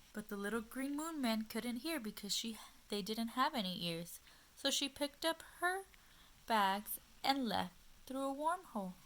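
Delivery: gentle, expressive storytime voice; reading to children. A narrator's reading. Teacher reading a picture book to little listeners, gently expressive. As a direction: read it like a picture book at bedtime.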